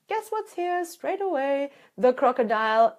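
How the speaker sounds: ordinary talk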